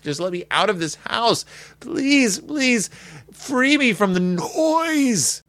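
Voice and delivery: high-pitched, hammed-up desperate plea; begging. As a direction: plead like a parent at the end of his rope, slowing at the end. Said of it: "He's begging for the sweet release".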